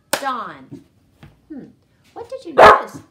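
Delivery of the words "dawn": louder, single-beat "Dawn"; clapped syllable. Shown as a demonstration. Teacher clapping a name for pupils. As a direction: clap "Dawn" as one beat. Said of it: "Ready? Go".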